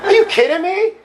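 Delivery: high, laughing disbelief. Real, not acted. Laughing at his own resentment, high and disbelieving.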